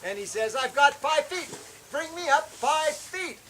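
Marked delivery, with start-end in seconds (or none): 0.6-1.5 higher, louder call; retold shout from below. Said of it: "he shouts at me". 1.9-3.4 higher, louder, slow call; retold shout from below. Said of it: "he shouts at me".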